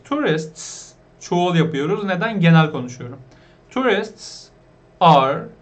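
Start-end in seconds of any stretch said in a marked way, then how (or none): 5.0-5.5 are said loud, as a teaching point; stressed are. Shown as a demonstration. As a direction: lean hard on are. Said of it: "özelliğini söylediğim için emizar kullanacağım".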